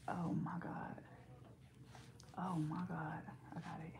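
barely audible whispered aside; whispering. Real, not acted. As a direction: whisper it under your breath. Hushed asides while handling baby.

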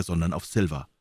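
plain reading voice

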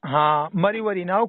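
ordinary talk

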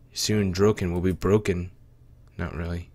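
usual speaking voice

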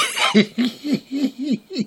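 long high giggle; squeaky tickled giggling. Real, not acted. Storyteller laughing about the baby's eyes, high giggle.

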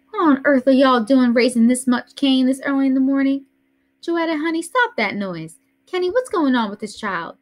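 lightly voiced fussing grandmother; an old lady scolding the noise; Grandma Sands. A narrator's reading. Grandmother fusses at children raising noise early, lightly voiced.

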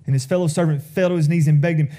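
ordinary talk, nothing marked

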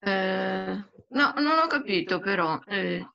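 usual speaking voice

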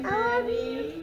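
drawn-out high I love you. Real, not acted. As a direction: sing out I love you slowly on request.

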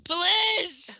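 high, loud mock plea; hammed-up begging cry; whiny yell. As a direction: beg like a wailing child. Begging teammate.

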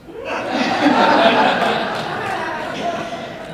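breathy burst of high laughter; big laugh. Real, not acted. Laughing hard after telling the surgeon off. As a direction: crack up at your own joke.

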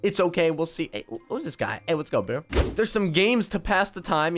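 usual speaking voice

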